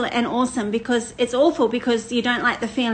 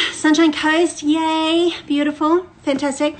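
excited shout-out; 5 semitones higher, 4 dB louder than usual. Real, not acted. Greeting viewers from Sunshine Coast.

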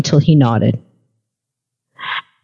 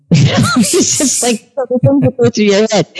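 laughing; 7 semitones higher than usual. Real, not acted. Woman explaining her thought to Justin, laughing. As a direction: laugh while explaining yourself.

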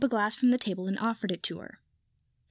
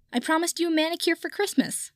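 called out; 7 semitones higher than usual. Acted. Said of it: "Pansy shouted back".

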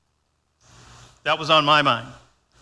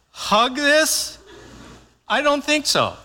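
squeaky, much higher incredulous scoff; mock disbelief; 11 semitones higher, slower than usual. Dad mocking his own reaction to his wife, pitched way up in disbelief. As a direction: play your own outraged disbelief, hammed up.

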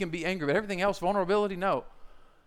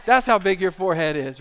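loud, punchy ribbing; roast session; 2 semitones higher, 8 dB louder than usual. Acted. Guys ribbing a buddy about losing hair, mocking. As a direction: hammer each jab home loudly. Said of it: "Like, just roast session".

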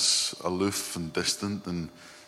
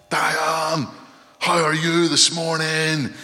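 loud, high bellowed greeting; comically overblown, sustained shouting; mock shout; 8 semitones higher, 7 dB louder, slower than usual. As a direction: holler a greeting across the whole house. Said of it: "if we just shouted".

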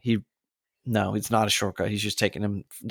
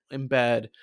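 normal talking voice

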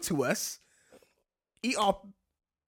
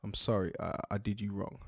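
low, soft mock-sincere boyfriend; hammed-up tender remorse; somber apology; 9 semitones lower, 9 dB quieter than usual. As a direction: apologise low and hushed, milking it. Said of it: "he's just like".

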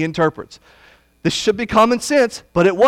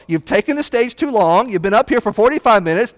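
usual speaking voice

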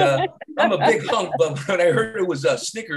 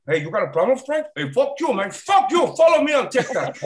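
lightly voiced gangster, rising to a shout; Tony Montana; 7 semitones higher than usual. Tony Montana yelling at Frank, lightly voiced gangster. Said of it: "if Tony Montana said that".

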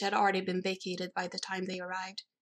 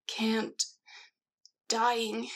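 groaning; 3 semitones higher than usual. A narrator's reading. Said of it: "Bella groaned".

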